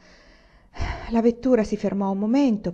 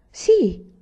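eager yes; 11 semitones higher, 7 dB louder than usual. Acted. Boy answers mother's question.

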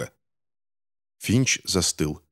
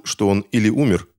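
usual speaking voice

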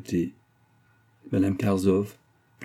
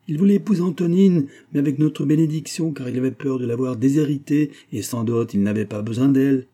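higher, louder angry tirade; carried away by anger; 6 semitones higher, 5 dB louder than usual. A narrator's reading. Mother going on against the daughter's suitor, carried away by anger. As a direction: rail at him, voice raised. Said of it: "Celle-ci, emportée par sa colère".

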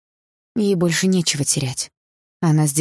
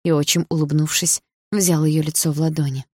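ordinary delivery

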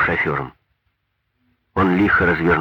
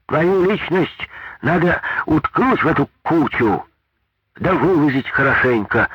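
high, slow angry yell; shouting; 10 semitones higher than usual. A narrator's reading. Big woman shouting a threat at the foreman. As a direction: threaten him at full voice. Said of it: "крикнула могучая женщина".

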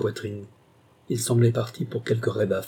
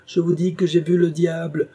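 higher, louder furious claim; angry insistence; 6 semitones higher, 4 dB louder than usual. A narrator's reading. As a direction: insist in fury. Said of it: "sa colère se rua encore sur Alan".

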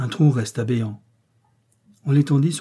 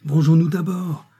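higher, louder cry for revenge; rally them with a raised voice; yelling; 5 semitones higher, 5 dB louder than usual. A narrator's reading. Enraged ringleader calling for vengeance, yelling. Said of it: "hurla-t-il".

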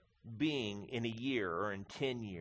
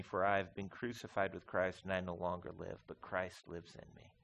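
soft, reverent reading of the verse; quiet scripture reading; 6 semitones lower, 5 dB quieter than usual. Acted. Pastor reading Galatians to congregation, soft and reverent. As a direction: read it hushed, like a prayer.

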